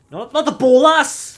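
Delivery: high, loud cry of dismay; alarmed shout. Real, not acted. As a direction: yell out at a sudden slip-up. Wrong gadget thrown mid-game.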